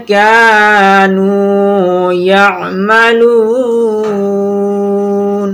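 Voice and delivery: very slow, melodic chanted tajweed; Quran recitation. Shown as a demonstration. Teacher reciting the verse for students to follow, slow chant. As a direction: chant each phrase long and even.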